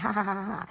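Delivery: imitating a scornful crowd's ha ha ha; hammed-up jeering laugh; scornful cackle at Jesus; mock laughter. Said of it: "it's like they laughing, like".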